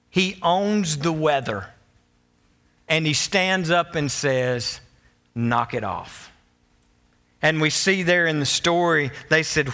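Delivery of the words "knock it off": low, calm command; understated rebuke. Retold or imitated. Jesus stands up to the weather, low understated rebuke. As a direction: tell it off without raising the voice. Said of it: "Jesus rebukes".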